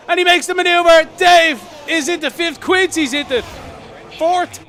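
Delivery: loud, fast, excited race call; excited shout. Real, not acted. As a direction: shout the overtake as it happens. Overtake called live in race.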